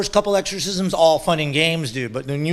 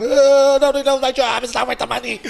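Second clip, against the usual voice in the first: high, loud mock whine; whiny complaint; 8 semitones higher, 4 dB louder than usual. Shaman mocking his own crying before a ceremony, high whine. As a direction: whine about your job like a crybaby.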